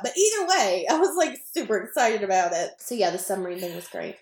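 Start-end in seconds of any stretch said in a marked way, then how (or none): none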